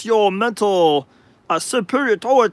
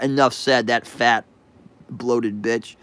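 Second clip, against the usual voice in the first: normal talking voice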